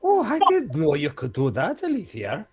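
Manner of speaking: hammed-up Welsh accent, a bit off; attempted Welsh accent. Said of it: "He said that was Welsh".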